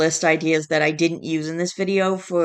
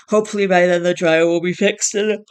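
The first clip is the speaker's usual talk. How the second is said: words stretched by a yawn; 4 dB louder than usual. Real, not acted. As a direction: talk through a yawn. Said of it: "I need to stop yawning".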